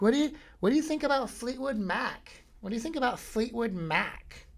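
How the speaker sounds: high mock girl voice; girl at bar. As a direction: raise the pitch to play the girl at the bar. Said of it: "She's like".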